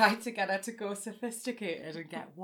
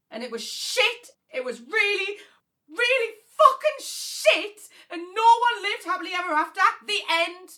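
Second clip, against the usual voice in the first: much higher and louder, near a shout; furious outburst; 11 semitones higher, 9 dB louder than usual. Acted. Sister ends the elf's tale with a loud angry outburst. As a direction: let rip with the anger, then snap the ending.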